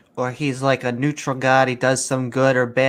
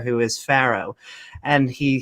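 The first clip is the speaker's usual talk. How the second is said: ordinary delivery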